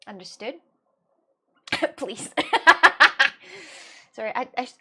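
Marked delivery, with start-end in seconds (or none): 2.3-4.1 a loud, high, strange burst of laughter; real loud laughing fit; odd laugh. Real, not acted. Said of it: "why do I laugh like this?".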